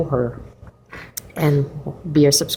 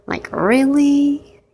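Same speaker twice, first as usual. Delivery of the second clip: incredulous; 9 semitones higher, much slower than usual. Real, not acted. Mocking a dull board entry.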